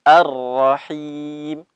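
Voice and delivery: slow Ar-Rahim, final m stopped; Quranic recitation. Shown as a demonstration. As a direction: end Ar-Rahim on a silent m, slowly. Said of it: "ketika kita matikan". (bacaan pelan diwaqafkan)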